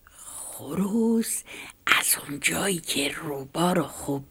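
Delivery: hushed bedtime story whisper; whispered storytelling. A narrator's reading. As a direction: tell it in a secret whisper to children.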